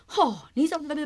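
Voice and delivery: high, loud indignant outburst; scolding. Retold or imitated. Mother scolding her late daughter as she gets in, exasperated. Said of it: "我就开始会骂".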